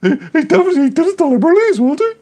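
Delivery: high, loud whiny schoolkid voice; a kid telling tales to the teacher; tattling child. Retold or imitated. A dad playing a child complaining to the teacher. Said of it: "I'm telling the teacher, like".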